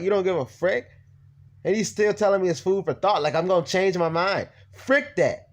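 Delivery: high, outraged venting; fed-up, incredulous rant; indignant. Retold or imitated. Speaker retelling the officer's dismissal, indignant.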